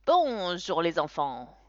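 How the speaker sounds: slow, loud showman's hello; clown greeting. A narrator's reading. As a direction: greet the kids like a stage clown. (salut lent et sonore de clown)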